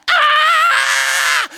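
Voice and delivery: loud, very high "aah" scream; long high scream. Retold or imitated. Rider on a runaway horse, arms in the air, screaming. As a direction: scream it out in mock terror. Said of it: "I was going".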